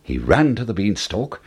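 usual speaking voice

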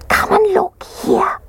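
high, slow, squeaky whisper; whispered Baby Bear. A narrator's reading. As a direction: whisper it like an awed little cub. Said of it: "he whispered".

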